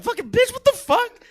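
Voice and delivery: a high, loud shriek of outrage; shrieking prostitute. Retold or imitated. Prostitute shrieking at the comic grabbing her, high and loud. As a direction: shriek it like she's been groped.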